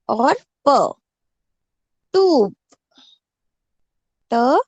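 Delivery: टूप split into ट and प, each said loudly; spelling out sounds. Shown as a demonstration. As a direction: break टूप into its sounds for the class. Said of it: "ट को छोटी ऊ की मात्रा". (टूप को ट और प में तोड़कर बोलना)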